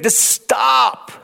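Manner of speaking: high shout of just stop. Retold or imitated. Moses shouting at the panicking crowd to stop. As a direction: bark the stop out.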